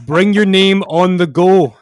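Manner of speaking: hammed-up loud declaiming of the chorus; loud dramatic declaiming. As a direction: belt the lyrics out like a big finale. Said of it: "do a dramatic reading of the lyrics".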